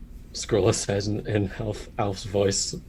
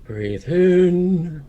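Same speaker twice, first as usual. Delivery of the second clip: put-on old master's voice; 3 semitones higher, 4 dB louder, much slower than usual. Bird mocking his old master's voice. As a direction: mimic the old teacher's patronising calm. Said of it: "old master's voice kinda goes".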